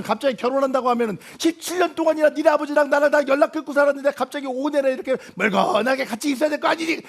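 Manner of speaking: normal talking voice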